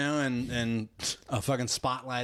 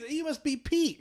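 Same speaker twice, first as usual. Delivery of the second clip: much higher excited voice; eager. Retold or imitated. Kevin Bacon greeting Quill, much higher and eager. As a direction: gush with eager recognition.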